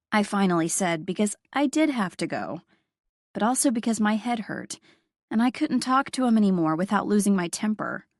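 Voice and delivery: usual speaking voice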